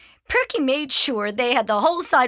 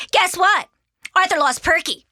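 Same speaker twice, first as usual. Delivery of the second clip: put-on high, loud kid's announcement; cheeky, piping tattle; tattling little sister; 8 semitones higher, 6 dB louder than usual. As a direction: blurt out a brother's mistake.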